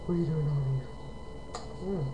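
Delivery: ordinary talk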